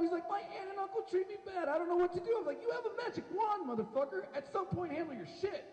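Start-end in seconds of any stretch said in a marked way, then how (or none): 0.3-2.4 high-pitched whiny complaint; mock a whining boy, pitched way up; Harry Potter. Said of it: "Harry Potter's the most whiniest". 2.6-5.6 high-pitched exasperated retort; exasperated scolding. Acted. Acted scolding of whining Harry.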